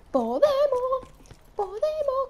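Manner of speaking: high-pitched hopeful cheer; will yourself on; high, drawn-out self-encouragement. Real, not acted. Encouraging herself mid-fight.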